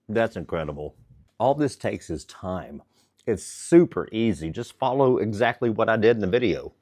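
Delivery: talking through a mouthful; chewing while talking; mouth full. Real, not acted. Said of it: "talking with my mouth full".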